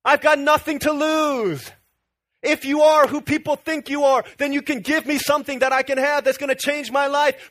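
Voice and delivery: loud, high-pitched impassioned plea; desperate, full-force preacher's shout; calling out. Acted. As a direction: cry out to be heard over a crowd. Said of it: "He starts calling out".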